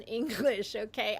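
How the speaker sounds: normal talking voice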